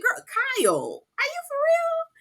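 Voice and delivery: high, slow squeal of disbelief; incredulous shriek at the TV. Retold or imitated. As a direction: stretch it out way up high, like you can't believe her. Reacting to Kyle on the show.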